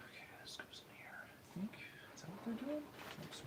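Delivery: soft unvoiced whisper. Real, not acted. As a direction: whisper it off mic. Aside while setting something up.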